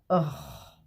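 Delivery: usual speaking voice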